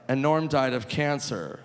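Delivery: ordinary talk, nothing marked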